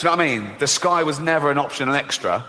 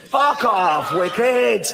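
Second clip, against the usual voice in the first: mock shout; 6 semitones higher, 3 dB louder, much slower than usual. Repeated mock shout, louder.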